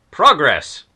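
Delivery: loud mock-triumphant cheer; triumphant exclamation. Real, not acted. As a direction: punch it out like a small victory. Cheering women's role in story.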